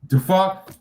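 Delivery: high, loud disbelieving yelp; shocked. Real, not acted. Streamer reacting to a card, shocked and loud. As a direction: jump up in shock.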